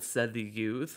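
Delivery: plain reading voice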